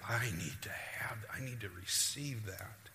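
soft, earnest whisper; hushed realization. Retold or imitated. Acted hushed personal realization.

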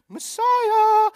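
loud, very high drawn-out cry; triumphant exclamation. Acted. Crowd member proving a point from prophecy, triumphant exclamation. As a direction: call it out high like the clinching proof.

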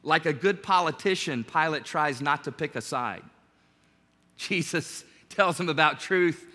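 usual speaking voice

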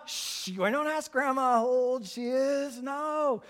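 high, slow mock hushing; acted parent's high-pitched reproof; scolding parent. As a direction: hush a child with drawn-out embarrassment. Voices an adult hushing the child.

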